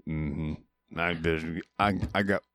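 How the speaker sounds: soft, halting backwoods character with grunts; Carl Childers. Acted. Host mimics the film's hero for his friend, halting grunted impression. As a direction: stammer it slowly and softly, grunting between phrases. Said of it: "talks like this".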